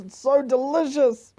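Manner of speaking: high, slow gushing over the food; drawn-out rave. Real, not acted. Raving to friends about breakfast, high and drawn out. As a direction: stretch out "so" with delight.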